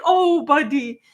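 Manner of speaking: fond rueful pity; pitying oh buddy. Shown as a demonstration. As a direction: console a dog that bonked his head. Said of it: "That was the voice".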